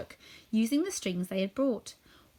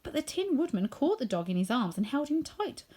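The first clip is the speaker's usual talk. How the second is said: ordinary delivery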